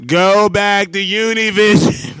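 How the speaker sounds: loud, slow mock shout; retold heckler put-down. Host retelling Trump's answer to hecklers, loud mock shout. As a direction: belt it out slowly as an imitation. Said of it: "He was like".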